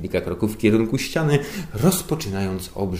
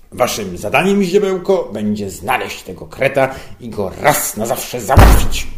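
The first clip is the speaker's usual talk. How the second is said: pompous boss; 2 semitones higher, 8 dB louder than usual. A narrator's reading. Boss ordering his underling to catch the mole, pompous and loud.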